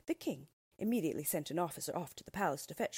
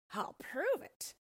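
high, boastful ogre voice; proud ogre; 8 semitones higher than usual. A narrator's reading. Ogre offers the cat a demonstration, proud high boast. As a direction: draw out the boast, pitched well up. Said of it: "said the org proudly".

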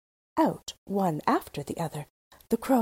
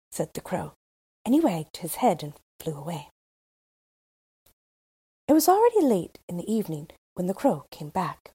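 ordinary delivery

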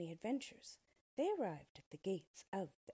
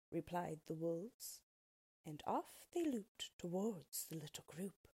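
ordinary delivery, nothing marked

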